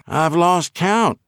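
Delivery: slow, high hillbilly twang. A narrator's reading. Prisoner answers the male nurse, light hillbilly twang. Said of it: "said in his native hillbilly twang".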